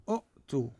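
"أؤتوا" as u'-too, short u cut by a glottal stop; original hamza form. Shown as a demonstration. Teacher showing the word's origin, "أؤتوا" with a glottal stop. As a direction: break "أؤتوا" after the first vowel with a catch. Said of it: "أوتوا أصلها شنو؟".

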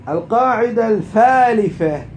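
"الفالفة" with f for th, drawn out and loud; dialect pronunciation, announced. Shown as a demonstration. Teacher announcing the third rule in dialect, emphatic dialect f in "الفالفة". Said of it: "بلهجتنا".

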